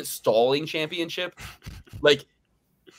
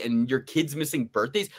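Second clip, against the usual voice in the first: ordinary talk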